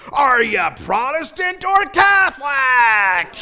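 slow, booming mock shout; hammed-up, drawn-out loudmouth question; really loud passenger. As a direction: holler it for the whole plane to hear. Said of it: "everyone around us can hear all this".

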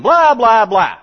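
loud, high blah-blah-blah; blaring mock yammering; mock chatter. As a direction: burst out high and loud, mocking nonstop talk. Answers as the talking teacher.

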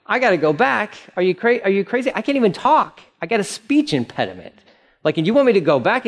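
a flustered man begging off the job; panicky Moses. Acted.

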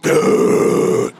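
very loud mock growl; mad groan. Mimicking wife mad about husband's shoes, exaggerated loud groan. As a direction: blast out a long grouchy groan. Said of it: "You mad 'cause your husband left his shoes".

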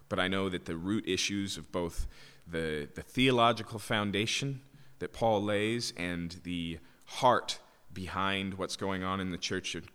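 low, subdued praying voice; prayer. Real, not acted. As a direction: pray quietly and earnestly, pitched low. Leads a prayer.

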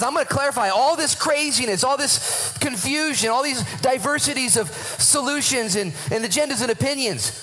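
high, impassioned and insistent; fired-up preacher, stretching the key words; excited preaching. Real, not acted. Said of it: "I'm excited".

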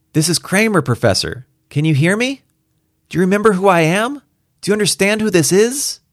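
high, insistent radio hail; urgent Kramer calling out. Acted. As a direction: call out to someone who may not hear. Kramer calls again.

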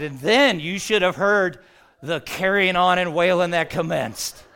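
loud, hammed-up Southern storyteller; imitated Southern drawl, belting out the uproar; Jerry. Funeral director describing the mourners' uproar, loud Southern accent. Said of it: "imagine a thick Southern accent".

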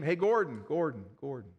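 mock cave shout dying into an echo; calling into a cave. Pastor showing a cave echo to the congregation, calling then fading. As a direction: call Gordon out, then let it fade like an echo. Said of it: "you shout out somebody's name".

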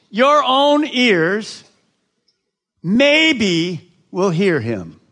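slow, loud "maybe" slipped in as a test; wrong word, mock doubtful. Shown as a demonstration. Pastor misquoting the verse to check the congregation. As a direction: misread "maybe" on purpose to test the crowd. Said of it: "Is that what it says?".

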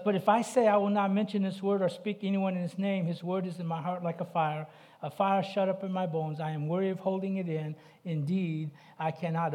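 preacher reading Jeremiah's lament; impassioned scripture reading. Acted. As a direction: proclaim the verse with force. Reads scripture aloud.

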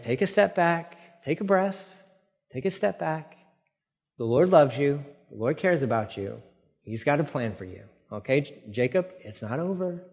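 hushed, gentle phrases with room to breathe; calming reassurance. Pretend. Preacher tells Jacob the Lord cares, soft calming reassurance. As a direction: softly talk someone down.

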